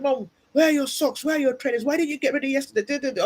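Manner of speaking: high-pitched mock scolding of mum; nagging daughter. Grown daughter telling off her mum before the gym, nagging. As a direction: imitate a daughter reeling off reproaches. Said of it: "I'm like, oh, she said".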